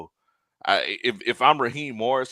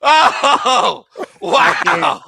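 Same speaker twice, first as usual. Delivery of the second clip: laughing; 11 dB louder, much slower than usual. Real, not acted. Cracking up at a joke.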